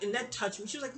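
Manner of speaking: ordinary talk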